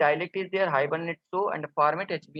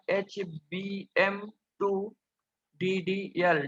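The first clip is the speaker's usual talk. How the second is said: slow, letter by letter; drawn out, pausing between the letters; spelling it out; 4 dB quieter, slower than usual. Real, not acted. Instructor spells out the property name for learners, slowly.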